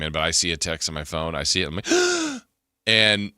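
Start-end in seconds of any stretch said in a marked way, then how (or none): 1.9-2.4 sharp, sudden high gasp; emotional gasp. Retold or imitated. Host re-enacting seeing the text, sudden emotional gasp. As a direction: suck in breath at startling news. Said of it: "it was one of those gasps".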